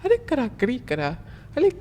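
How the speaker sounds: imitated Swedish, played silly; mock Swedish. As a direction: babble nonsense in a fake Swedish lilt. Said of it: "when they speak in their language".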